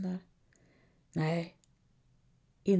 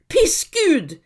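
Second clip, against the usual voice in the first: higher, louder furious curse; angry scream; 8 semitones higher, 6 dB louder than usual. Acted. Helga curses the silent God, angry scream. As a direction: spit the curse up at heaven. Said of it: "skriker Helga".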